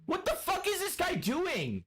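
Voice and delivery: very high, slowed, stretched disbelief; incredulous disbelief. Real, not acted. Streamer hearing weird noises from a friend's mic, very high incredulous squeal.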